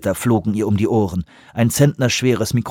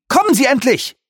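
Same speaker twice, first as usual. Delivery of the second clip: high, impatient yell; shouted. A narrator's reading. Lee urges Rubin out of the lab, shouting. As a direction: yell at him to hurry up. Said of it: "schrie Lee".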